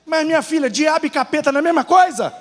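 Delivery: high, protesting comic retort; indignant self-defence. Acted. Grandfather defending his mix-up to his wife, high indignant protest.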